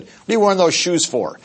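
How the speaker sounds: a hint of an irritated organizer; mild mock indignation. Boss to speaker over tennis shoes, mildly indignant. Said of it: "He said".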